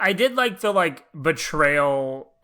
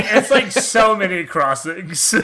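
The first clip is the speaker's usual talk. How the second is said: louder, higher, through real laughter; laughing exasperation; 5 semitones higher, 6 dB louder than usual. Real, not acted. Co-host overwhelmed by endless double crosses, laughing. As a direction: crack up while saying it.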